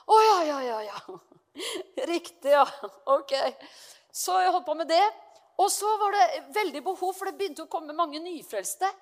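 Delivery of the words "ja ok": ordinary delivery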